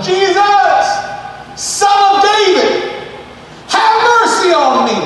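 high, slow desperate cry for mercy. Acted. Blind beggar shouting to passing Jesus, high desperate cry. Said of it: "started shouting".

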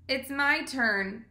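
very slow and firm; insist, slowly, it's her turn; slow insistent. Acted. Fern demands her turn.

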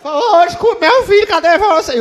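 husband stammering to wife in Shaggy voice; Shaggy voice crack. Acted. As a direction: stammer it out in a squeaky teen voice. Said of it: "eu virei um salsicha".

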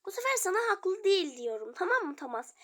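ordinary talk, nothing marked

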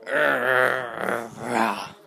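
long, loud exasperated growl; drawn-out "arrgh" of annoyance; frustrated growl. Real, not acted. Vlogger whose website won't work, frustrated growl.

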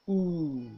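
"Ooh" long, with the lips forward and flattish as if to whistle; model oo vowel. Shown as a demonstration. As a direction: hold "Ooh" with a slight opening between the lips. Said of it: "lips are forward but flattish as if to whistle".

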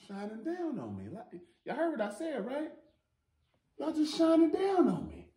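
high soft falsetto gratitude; sing-song high thankful voice; falsetto thanks. Real, not acted. Vlogger thanking the organizers, soft high falsetto.

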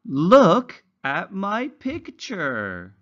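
slow, high, sing-song storybook reading; reading to children. Acted. Teacher reading a simple story to young English learners, slow and sing-song. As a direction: read it slowly like a picture book for little ones.

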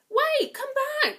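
high, loud call; calling after her. Acted. Prince calls after the fleeing Cinderella, high and loud. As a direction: call to someone running away. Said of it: "called the prince".

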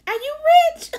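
put-on high, slow awed little-girl voice; lightly squeaky kid voice; child niece voice. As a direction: ask like an amazed ten-year-old. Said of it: "Now she".

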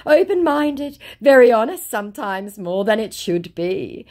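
high, gushing mock scammer. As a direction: ham up the scripted sweet talk. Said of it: "my scammer responds with".

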